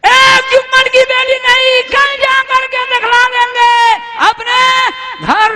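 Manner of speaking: high, loud shouting wife; shrieking wife's defiant cry; furious defiance. Acted. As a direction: scream the threat at him. Quarrelsome wife defies oath.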